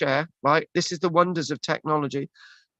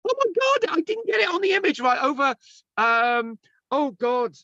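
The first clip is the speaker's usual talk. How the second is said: much higher, startled exclamation; flustered surprise; 9 semitones higher than usual. Real, not acted. Presenter realising the image is wrong, startled. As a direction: yelp it as the slide fails. Screen share goes wrong.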